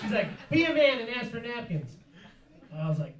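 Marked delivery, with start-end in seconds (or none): 0.5-1.9 hammed-up scolding wife, louder and higher; bossy wife pushing him, pitched up; nagging wife, louder.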